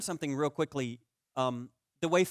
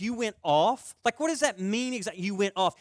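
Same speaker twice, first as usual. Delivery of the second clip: high incredulous questioning; pitched-up sarcastic kid brother; mock disbelief; 5 semitones higher, 3 dB louder than usual. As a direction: repeat it back in disbelief. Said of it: "I'm like".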